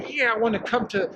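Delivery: normal talking voice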